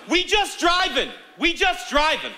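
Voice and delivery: high, drawn-out mock retort; imitating woman driver. Woman driver answering his lecture, high mocking imitation. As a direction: impersonate her comeback, pitched high and stretched out. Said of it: "she looks back and goes".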